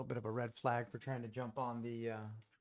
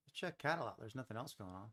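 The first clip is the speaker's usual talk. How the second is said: ordinary talk, nothing marked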